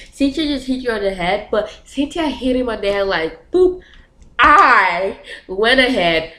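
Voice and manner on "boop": high, cute little boop; light, playful sound effect. Retold or imitated. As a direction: make the tap sound tiny and harmless. Mimics a light tap.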